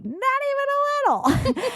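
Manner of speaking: very high, drawn-out refusal; squeaky, stretched-out no thanks; emphatic refusal. Acted. Turning down the brother's food, high drawn-out refusal.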